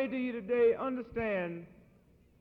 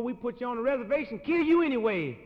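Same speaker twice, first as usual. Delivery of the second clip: loud threat; 4 semitones higher, 7 dB louder than usual. Retold or imitated. Cowboy threat.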